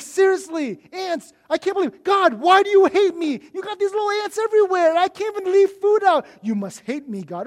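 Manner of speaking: a hammed-up tantrum at God over ants; whining complaint. Pastor acting out his whiny prayer about ants, whining. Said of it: "It's a lot of whining and complaining".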